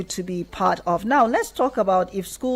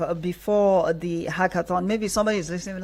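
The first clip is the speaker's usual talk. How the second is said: ordinary talk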